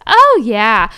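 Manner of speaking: punchy shouted slogan; loud brand shout. Acted. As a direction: belt out the brand name. Shouting the sock brand name.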